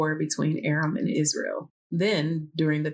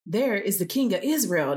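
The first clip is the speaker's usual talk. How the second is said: ordinary delivery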